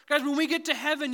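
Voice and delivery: fiery repeat of the opening line; full-force preaching. Acted. Same words repeated at the preaching peak.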